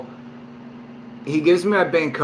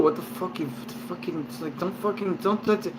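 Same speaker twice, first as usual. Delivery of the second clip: much softer, thinking to himself; inner thought; 2 semitones higher, 9 dB quieter than usual. Retold or imitated. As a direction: mutter it under the breath, as thoughts. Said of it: "thinking in my head".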